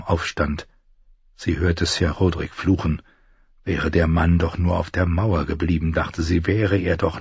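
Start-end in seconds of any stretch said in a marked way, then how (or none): none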